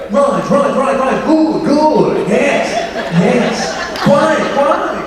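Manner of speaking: high, clipped toffee-nosed English chatter, laughing through it; posh uncle. Retold or imitated. Actor imitating his uncle the chin wagger, high posh voice. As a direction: rattle off pompous old-chap agreement. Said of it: "He's like".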